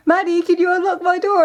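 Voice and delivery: high calling through the door, a touch lighter; sister calling again. Retold or imitated. Retelling the sister's locked-in call, lightly high. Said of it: "and I hear".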